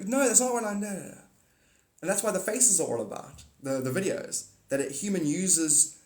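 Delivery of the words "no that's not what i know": mock protest. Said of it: "So I'll, I'll go".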